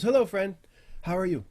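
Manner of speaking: high, loud, cheery greeting; friendly Aarakocra. Acted. Aarakocra turns back to greet a newcomer, high and friendly. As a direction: greet a stranger in a high bird-man voice.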